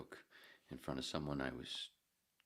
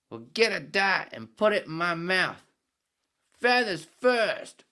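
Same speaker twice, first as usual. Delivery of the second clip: high, loud, slow Aussie demand; Australian accent; 14 semitones higher, 16 dB louder, slower than usual. Acted. Head demands the bartender light his cigarette, slow loud Australian accent. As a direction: drag it out as a brash Australian drinker. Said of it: "Australian accent keep going into British".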